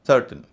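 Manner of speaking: सर्टेन said "SUR-tuhn"; short uh in the last syllable of सर्टेन; सर्टेन with unstressed ending; correct weak ending. Shown as a demonstration. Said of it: "इसका उच्चारण भी सर्टेन होता है".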